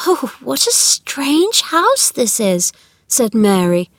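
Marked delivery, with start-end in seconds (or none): 0.1-2.7 put-on high, slow, marvelling girl's voice; wondering girl. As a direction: draw it out in puzzled wonder. Narrator voices Mary.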